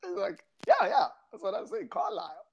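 high, giggly retelling through laughter; laughing through it. Retold or imitated. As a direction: squeeze the words out past the giggles. Retold quote while laughing.

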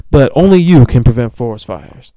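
booming slogan read; mock announcer. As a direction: boom it like a PSA. Quoting the Smokey Bear slogan.

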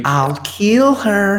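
high, very slow imitation of the movie henchman; movie thug line. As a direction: drag out a high mock threat. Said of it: "His famous line in B V S".

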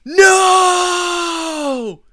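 high, loud cry of dismay; anguished shout. Retold or imitated. Finding the coffee isn't decaf, high anguished shout. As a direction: wail it in horror at the mistake. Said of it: "and I go".

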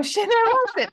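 ordinary talk

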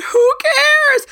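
who cares squeaked very high and loud; a sky-high scoffing cry; squealed dismissal. Real, not acted. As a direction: throw it away in a high squeal. Dismissing raking leaves.

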